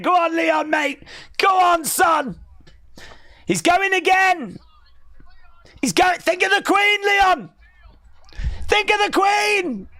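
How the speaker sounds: high shouted ringside cheering; wrestling commentator. Real, not acted. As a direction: roar him on like a commentator. Hyped live wrestling call.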